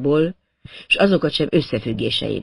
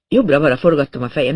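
plain reading voice

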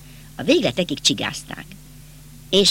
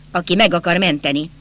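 ordinary delivery

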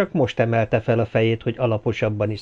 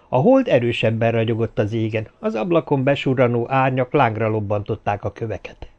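plain reading voice

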